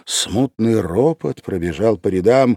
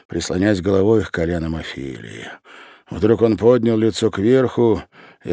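ordinary delivery

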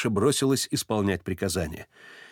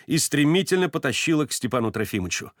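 usual speaking voice

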